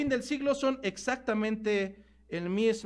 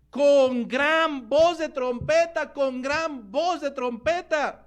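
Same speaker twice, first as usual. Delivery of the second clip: raised, slow, drawn-out heralding; ringing proclamation; 4 semitones higher, 4 dB louder, much slower than usual. Real, not acted. Preacher cites angels sent with a trumpet, ringing proclamation. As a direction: sound it out like a trumpet call.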